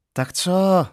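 high, loud call from another room; wife calling again from the bedroom, loud. Acted. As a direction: shout it through the door. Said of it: "opět se ozvalo z ložnice".

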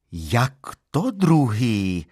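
wife storms in, raising her voice. Acted. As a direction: demand it, voice rising at her husband. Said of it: "zvýšila hlas".

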